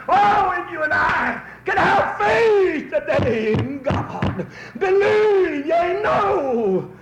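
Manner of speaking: high, loud, slow cry of faith; preaching at full force. Acted. Preacher urging the congregation to have faith, crying out high and loud.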